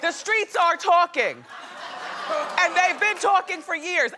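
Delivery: high, loud, slow teasing gossip jab; knowing taunt. Pretend. Harry taunting his dad about the rumours, mock sass. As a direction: rub the rumours in, laughing. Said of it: "You, you go".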